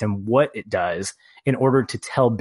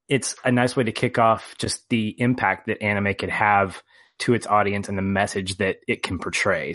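ordinary talk, nothing marked

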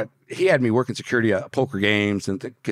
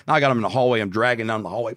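ordinary talk, nothing marked

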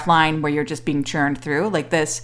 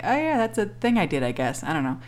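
much softer, offhand shrug; softer and softer, dismissive mumble; indifferent; 8 dB quieter than usual. Retold or imitated. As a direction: play him shrugging it off. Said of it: "he was kinda like".

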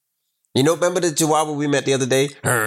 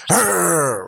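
pit bull growl; 4 semitones higher than usual. Retold or imitated. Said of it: "pit bulls that's gonna bite you like".

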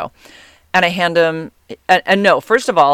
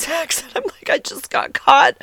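laughing; 10 semitones higher than usual. Real, not acted. Laughing at her own confession.

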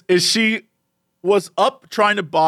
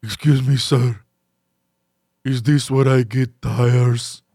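lower, slow stranger voice; slow lowered voice of a confused stranger; random dude; 8 semitones lower, slower than usual. Retold or imitated. Stranger asking son about tires, low and slow. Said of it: "some random dude comes up to me".

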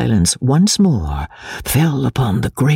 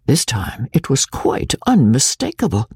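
ordinary delivery, nothing marked

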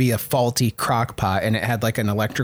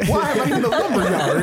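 Jimmy Stewart; 6 semitones higher than usual. Acted. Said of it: "I love Jimmy Stewart as a fire elemental".

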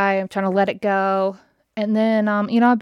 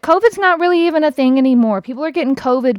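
louder, higher, quickened burst of exasperation; heated rant; 5 semitones higher, 6 dB louder, faster than usual. Real, not acted. Driver venting about the worker's sanitizing, heated rant. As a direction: rant it out, high and loud.

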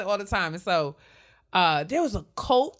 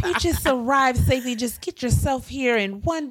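imitating mom; 7 semitones higher than usual. Imitating mom again, higher.

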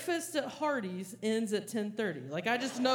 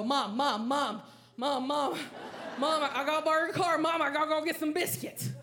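frantic calling; 4 semitones higher than usual. Retold or imitated. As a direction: yell for mom like the house is on fire. Begging mom for car keys.

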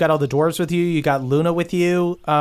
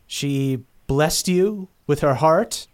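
ordinary talk, nothing marked

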